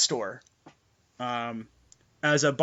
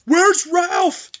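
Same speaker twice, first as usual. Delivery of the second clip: frantic shout; 13 semitones higher, 7 dB louder, much slower than usual. Acted. NPC calling out in panic.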